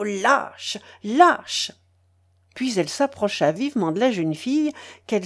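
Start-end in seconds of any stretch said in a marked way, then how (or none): 0.0-0.8 a muttered first lâche; contemptuous. A narrator's reading. Catherine insults Lougine. 1.0-1.7 lâche thrown out louder and rising; lâche cried out at him, higher; a second lâche, shouted; outraged. A narrator's reading. Catherine insults Lougine.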